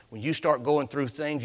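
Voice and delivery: ordinary talk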